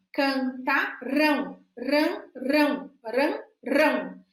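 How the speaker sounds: "Cantarão", then "rã, rão" split out slowly; syllable drill. Shown as a demonstration. Teacher corrects dyslexic students by splitting "rão". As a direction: isolate the "rão" of "Cantarão" and repeat it. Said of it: "faça a correção".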